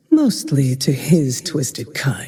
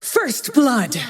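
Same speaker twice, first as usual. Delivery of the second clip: pitched much higher, triumphant call; exultant cry; 10 semitones higher than usual. Acted. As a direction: crow it out like a thrilled hunter. Game kill bark, many takes.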